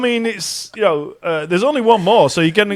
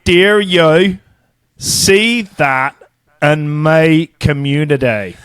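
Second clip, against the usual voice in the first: slow, exaggerated Belfast accent; deliberate mock Northern Irish accent; phonetic Belfast accent; much slower than usual. Shown as a demonstration. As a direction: spell out the Belfast vowels word by word. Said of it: "I'll read it phonetically".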